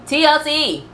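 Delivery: high-pitched hail on "T L C"; calling out. Pretend. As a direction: call "T L C" out sharply high to get attention. Calling out to the network.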